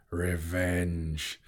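drawn-out, weighty exclamation; scornful dragon pronouncement. A narrator's reading. Smaug mocks the threat of revenge, heavy and scornful. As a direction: pronounce it heavily, with contempt. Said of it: "he snorted".

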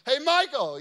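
loud, high call down the hallway. Retold or imitated. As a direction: call the boy's name loudly. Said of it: "So I yell out one of those".

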